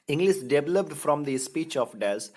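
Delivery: slow, deliberate question reading; quiz reading. Shown as a demonstration. Teacher reading a quiz question slowly to the class. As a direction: spell out the question slowly for students.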